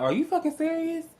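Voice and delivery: very high, exasperated question; incredulous. Retold or imitated. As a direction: shoot the pitch up in disbelief. Acted reaction to slow checkout line.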